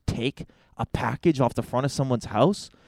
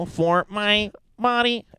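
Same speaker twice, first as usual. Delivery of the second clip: robot voice; 7 semitones higher than usual. Pretend. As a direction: speak like a glitching robot. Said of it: "malfunctioning as the robot".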